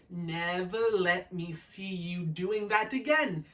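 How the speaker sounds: slow, drawn-out mock scolding; Sakia-san. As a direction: sister mimicking the stern maid. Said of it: "just like Sakia-san".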